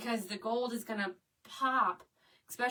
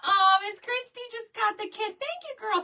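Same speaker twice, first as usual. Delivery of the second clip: high, loud, thrilled announcement; gushing, high-pitched gratitude; excited thanks; 10 semitones higher, 8 dB louder than usual. Real, not acted. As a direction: burst out with delighted news. Viewer just bought the kit.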